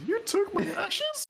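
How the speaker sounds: high, slow ghostly wail; eerie spirit voice, drawn out. Acted. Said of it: "You heard Jin's ghostly voice".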